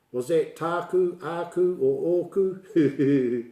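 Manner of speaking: fretting learner. Retold or imitated. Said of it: "get too hung up with".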